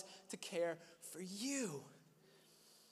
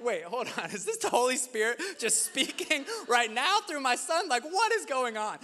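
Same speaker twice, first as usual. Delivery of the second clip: higher, louder amazed disbelief with a laugh; incredulous realization; 6 semitones higher, 7 dB louder than usual. Retold or imitated. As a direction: caught off guard, laughing at the thought. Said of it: "And I was like".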